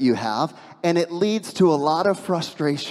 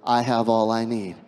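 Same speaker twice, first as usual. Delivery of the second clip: calm affirmation; 7 semitones lower than usual. Shown as a demonstration. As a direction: lead the congregation gently. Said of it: "Can you say that with me".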